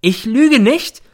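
very high, slow, loud bellow; a mother bursting out at her son. A narrator's reading. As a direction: roar it out suddenly. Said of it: "brüllt sie plötzlich los".

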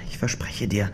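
hushed promise, unvoiced; whispering. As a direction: whisper it close. Versprechen an Verletzten. (flüsternd)

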